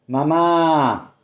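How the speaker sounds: a big, loud, falling bellow across the crowd; bellowing like a bull. A narrator's reading. A husband bellows for his wife in the crowd, like a bull. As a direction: call out to her like a bull lowing. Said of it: "gritó como si mugiera un toro".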